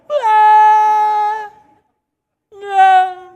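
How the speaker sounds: loud, high panicked scream as he falls; hammed-up falling scream; terrified scream. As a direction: scream in real fright going over the edge. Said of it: "And I'm like".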